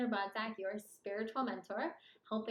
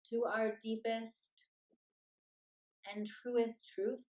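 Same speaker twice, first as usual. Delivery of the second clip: unhurried meditation voice with long pauses; guided meditation. Acted. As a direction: lead them gently into stillness, phrase by phrase.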